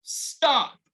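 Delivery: high, bold "Stop"; a high, strong call of "Stop"; firm stop. Shown as a demonstration. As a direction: read it out with the children. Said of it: "let's say it together".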